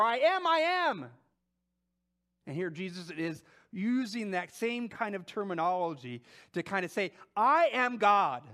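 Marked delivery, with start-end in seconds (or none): 0.0-1.1 slow, higher and louder divine declaration; God proclaiming his own name, slow and grand. Acted. Voicing God naming himself. 7.4-8.4 louder, slow "I am God"; loud, slow declaration of godhood. Acted. Jesus declaring divinity.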